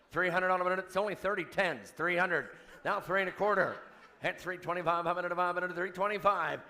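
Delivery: rapid auctioneer chant. Real, not acted. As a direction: rattle off the bids like an auctioneer.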